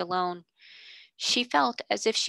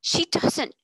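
She doesn't. cried louder and higher; passionate insistence; 9 semitones higher, 4 dB louder than usual. Acted. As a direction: fling out She doesn't. in outrage. Passionate outburst, repeated denial.

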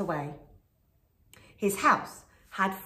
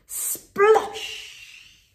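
loud high sploshing effect; loud unvoiced splosh; splash sound; 13 semitones higher, 8 dB louder than usual. A narrator's reading. Wolf lands in pot.

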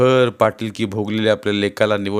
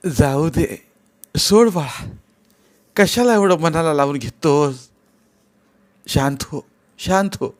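soothing mother; 5 semitones higher than usual. Acted. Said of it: "समजावण्यासाठी त्याच्याजवळ जाऊन".

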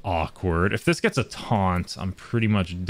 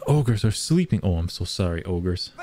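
ordinary talk, nothing marked

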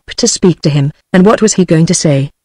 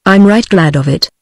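plain reading voice

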